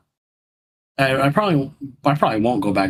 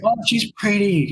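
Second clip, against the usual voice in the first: put-on high, slow, dreamy kid voice; imitating child's admiration; 9 semitones higher than usual. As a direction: draw it out high like an impressed little girl.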